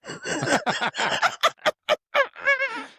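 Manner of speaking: high, helpless real laughter; long laugh. Real, not acted. Laughing at her own T-shirt story, real amusement. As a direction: burst out laughing at length.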